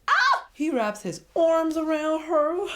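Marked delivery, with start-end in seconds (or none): 0.1-0.5 much higher, loud delighted shriek; short joyful scream; excited squeal. Real, not acted. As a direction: shriek like a fan. Squeal at the hug.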